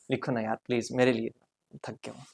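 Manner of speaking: soft, fading fake-weary plea for pity; mock tired plea. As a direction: play exhausted, begging them softly to write. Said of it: "मजाक कर रहा हूँ".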